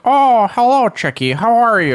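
high, lilting Southern mother impression; mom's Southern voice. Son imitating mom's phone greeting, high Southern voice. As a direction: swoop up high into a doting Southern mom greeting. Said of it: "do an impression of my mom".

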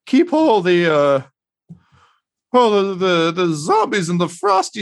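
a weary host with a sad smile; exhausted, beaten-down man. Acted. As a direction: greet them in a high, worn-out voice. Voices exhausted Mosifus Dirt.

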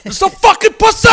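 high-pitched, hammed-up yell; screaming friend. Frustrated friend at a bar during the game, screaming. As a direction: imitate a desperate buddy screaming his complaint. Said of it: "Anybody who screams".

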